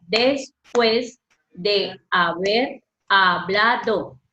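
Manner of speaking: slow, each syllable apart; teacher separating syllables. Shown as a demonstration. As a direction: spell out "Después de haber hablado" slowly in syllables. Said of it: "Mire cómo está separada la palabra".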